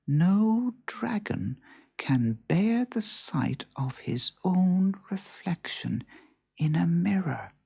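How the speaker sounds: slow, hushed, solemn reading; reading an old book. A narrator's reading. Joe reads a passage from his dragon book, hushed. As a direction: read it like a secret from an old tome. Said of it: "a passage that read".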